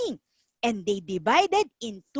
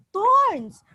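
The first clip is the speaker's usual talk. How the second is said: usual speaking voice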